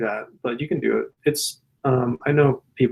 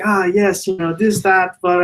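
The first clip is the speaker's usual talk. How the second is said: imitating an older Italian man, pitched higher; chatty mock Italian accent, raised in pitch; thick Italian accent; 6 semitones higher than usual. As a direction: put on a high Italian-accented voice. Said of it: "like, thick Italian accent".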